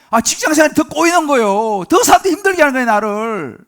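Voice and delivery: high, loud grumbling protest; frustrated complaint. Acted. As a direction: complain as if nothing is working. Acting out frustrated believer's complaint. (답답해서 터뜨리는 불평)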